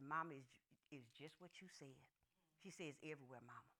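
very low, hushed daughter; quiet. Retold or imitated. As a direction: confide it gravely, almost under the breath. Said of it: "they was quiet".

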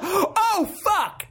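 high terrified squeal; frightened. Retold or imitated. Player seeing the axe, terrified high yelp. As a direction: yelp in sudden fear.

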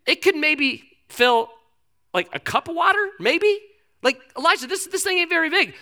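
high, hesitant put-on scepticism; doubtful servant. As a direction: play it down haltingly, pitch climbing. Doubtful servant plays down the cloud.

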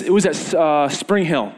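ordinary talk, nothing marked

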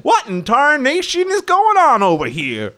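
put-on high, loud old-timer yokel; old prospector. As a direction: holler like a crusty old prospector. Sheriff character bursts in.

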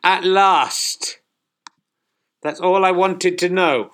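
loud, high fed-up relief; exasperated relief. Acted. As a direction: finally, throw it out loud. Relief after correct answer.

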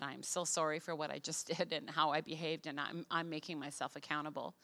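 soft, low retold apology; apologetic. Wife apologizing to husband after outburst, soft and apologetic. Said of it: "I just apologized".

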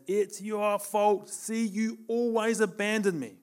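drawn-out, high-pitched accusation; accusing inner voice. Retold or imitated. Preacher voices the rage inside him, accusing. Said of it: "something in me that says".

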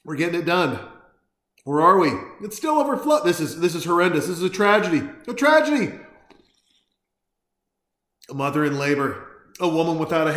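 high, loud comic alarm; mock panic. Real, not acted. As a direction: overdramatic dismay at spilling beer. Beer overflowing, mock panic.

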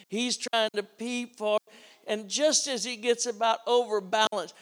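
ordinary delivery

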